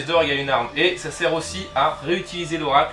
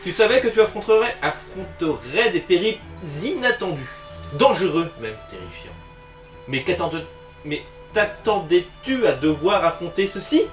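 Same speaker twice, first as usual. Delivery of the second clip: dramatic reading; 4 semitones higher, 4 dB louder than usual. Acted. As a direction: read it like an adventure narrator. Reads the danger card dramatically.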